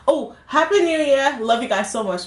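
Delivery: high, loud cheery goodbye; between kisses. Real, not acted. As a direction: burst out happy farewell.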